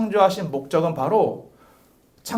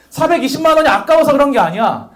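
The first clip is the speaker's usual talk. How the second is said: higher, louder insistent denial; friend insisting; 7 semitones higher, 6 dB louder than usual. Retold or imitated. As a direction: protest too much, unconvincingly. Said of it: "솔직히 아까웠겠죠".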